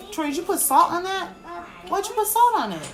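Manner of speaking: high, startled question about the salt; sudden pitch jump at her son; surprised. Real, not acted. Mom surprised her son salted his food, high and startled.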